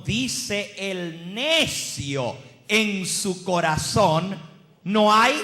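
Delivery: slow, very loud preaching; prédica enfática. Real, not acted. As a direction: thunder out the verse word by word. Predica el versículo completo. (versículo proclamado lento y a toda voz)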